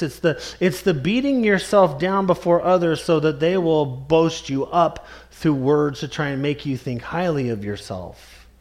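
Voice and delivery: ordinary delivery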